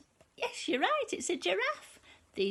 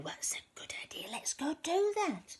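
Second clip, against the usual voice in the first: whisper; 4 semitones higher, 4 dB quieter than usual. Acted. Skeletons plotting to frighten.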